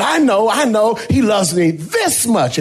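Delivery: put-on the tall boy crowing he knows; excited child. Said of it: "the tallest student in the class said".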